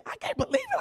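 mock squeal of disbelief, pitch leaping up; a hammed-up squeak of disbelief. Repeated mock complaint.

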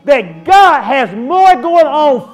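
full-force preaching. Acted. Preacher to congregation at the climax, shouting. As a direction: preach it to the rafters.

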